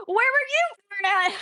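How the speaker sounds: very high mock comeback, YOU thrown back; mimicked retort. Man with an accent turns the question back, mimicked retort. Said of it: "the guy that had an accent".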